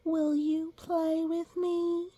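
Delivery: high, slow, sweet asking; childlike asking. A narrator's reading. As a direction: ask like a small hopeful child. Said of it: "I said".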